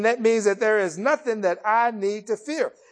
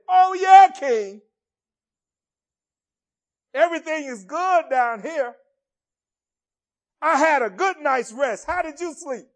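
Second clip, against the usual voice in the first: cheerful Daniel; 8 semitones higher, 5 dB louder than usual. Acted. Daniel answers from the den.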